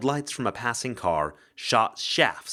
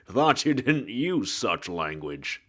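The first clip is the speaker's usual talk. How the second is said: hard-boiled ghost; slower than usual. Acted. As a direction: drawl it like a noir gumshoe. Ghost detective voice.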